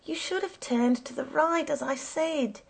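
high-pitched reproach; exasperated high protest; crying out. A narrator's reading. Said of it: "I cried".

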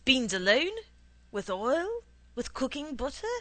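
impatient bean seller firing off options. A narrator's reading. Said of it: "He asked me impatiently".

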